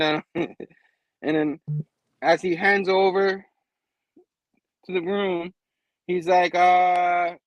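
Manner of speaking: usual speaking voice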